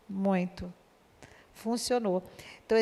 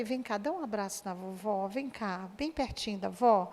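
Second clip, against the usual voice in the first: much softer, coaxing the granddaughter close; tender grandma; 6 dB quieter than usual. Retold or imitated. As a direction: hush her gently into a hug. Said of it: "eu falei". (avó falando baixinho e carinhosa com a neta)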